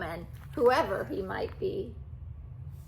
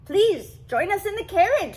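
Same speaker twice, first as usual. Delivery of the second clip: king; 9 semitones higher, 9 dB louder than usual. A narrator's reading. The king invites them.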